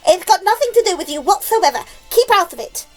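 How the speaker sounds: high, loud, rapid rebuke; scolding declaration. A narrator's reading. Aunt tells boy to keep out, loud scolding. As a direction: rattle off the telling-off loudly. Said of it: "Aunt Sponge declared".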